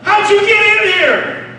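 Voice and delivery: high, loud startled cry; startled disciple. Acted. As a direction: ask it like a frightened man.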